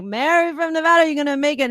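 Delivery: imitating the DJ egging on Mary. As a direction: cheer on the contestant. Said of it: "he's like".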